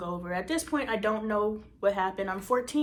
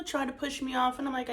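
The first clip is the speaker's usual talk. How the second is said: usual speaking voice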